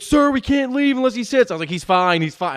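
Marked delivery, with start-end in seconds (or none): 0.0-1.5 high, loud mock ride attendant; urgent calling out. Ride staff to dad about his unseated son, urgent shout. 1.7-2.6 loud, raised-pitch yell back; retold yelling. Dad to teenage ride workers about his crying son, yelling. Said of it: "I'm, like, yelling at these teenagers".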